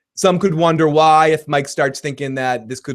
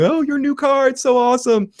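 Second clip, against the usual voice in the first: much higher, gushing over the car; gushing salon women; 11 semitones higher than usual. Retold or imitated. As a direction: squeal it like excited fans.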